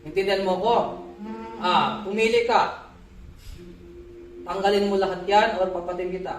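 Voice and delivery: ordinary talk, nothing marked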